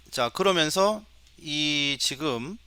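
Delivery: ordinary talk, nothing marked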